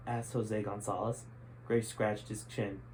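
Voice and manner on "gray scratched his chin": plain reading voice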